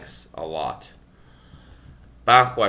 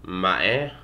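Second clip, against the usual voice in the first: MAE lifting in pitch at the end; upward intonation. Shown as a demonstration. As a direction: ask "Mae" with a rising questioning tune. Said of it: "the same contrast operates".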